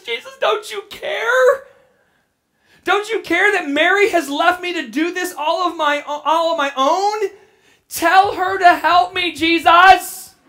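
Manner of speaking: whining Martha. Retold or imitated. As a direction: complain to Jesus like a sulky sister. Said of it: "She's throwing a fit".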